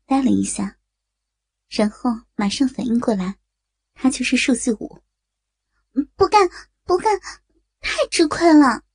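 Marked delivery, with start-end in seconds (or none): 5.9-8.8 higher-pitched reluctant refusal; bashful protest. A narrator's reading. Young woman refuses the game forfeit, bashful protest. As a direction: refuse in a raised voice, a little embarrassed. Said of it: "曾小艺显然有些害羞".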